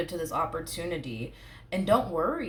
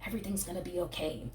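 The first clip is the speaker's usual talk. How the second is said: softer and quick, hushed comfort; soft reassurance; 7 dB quieter, faster than usual. Retold or imitated. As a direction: reassure them gently. Soothing follow-up.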